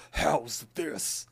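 lightly voiced evil pie; hint of a cartoon villain. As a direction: slip into a mock evil voice. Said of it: "it's, like, a evil voice".

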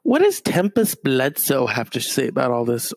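usual speaking voice

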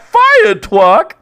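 "fire truck" with the r's softened to w, drawn out high; childlike w-for-r "fire truck"; imitated speech impediment. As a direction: say "fire truck" slowly as "fi-uh twuck". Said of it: "He hears one of the Special Olympics people go".

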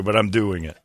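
ordinary talk, nothing marked

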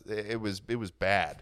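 ordinary talk, nothing marked

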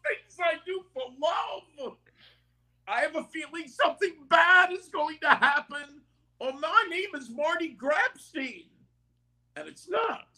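high trembling whiny cartoon dog voice; Courage the Cowardly Dog. Shown as a demonstration. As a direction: squeal it like a terrified little dog. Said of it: "give us one more demo of Courage the Cowardly Dog of the voice".

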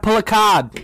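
high-pitched mock Boston accent, dropping the R; playful Boston accent. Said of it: "I almost went all Boston accent".

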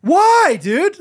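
high, loud playful disbelief; mock baffled. Dad replaying his reaction to his crying son, baffled squeal. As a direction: sound comically baffled.

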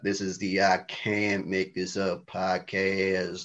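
low, slow, soft mimicry of an American podcast host; hammed-up American host voice, quiet and deep; Big T impersonation. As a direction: drop low and draw out the show intro in an American accent. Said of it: "impersonation of you".

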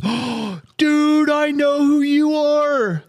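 imitating a starstruck clerk, squeaky high and slowed; gasp, then gush in a high mock-fan voice; excited recognition. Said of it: "backs away ... and goes".

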